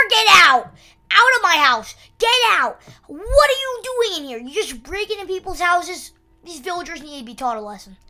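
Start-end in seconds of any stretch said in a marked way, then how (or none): 0.1-2.8 loud, high shouted eviction; yelling get out. Acted. Chasing villager out. 3.1-6.1 high, indignant scolding; mock scolding. Scolding intruding villager.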